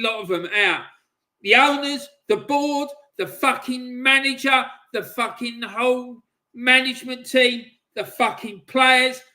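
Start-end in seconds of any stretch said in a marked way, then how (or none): 1.4-9.2 louder, higher, each name barked out; furious listing. Real, not acted. Fan demanding the whole club be cleared out, furious shouting. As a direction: hurl every name at them.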